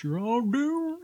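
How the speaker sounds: high, squeaky alien doom; Invader Zim voice. Retold or imitated. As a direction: shriek it like a tiny alien. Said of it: "Little Invader Zim there".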